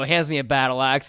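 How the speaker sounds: ordinary talk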